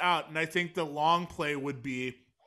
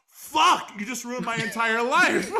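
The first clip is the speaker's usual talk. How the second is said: exasperated shout; 6 semitones higher, 5 dB louder, slower than usual. Retold or imitated. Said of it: "The guy's like".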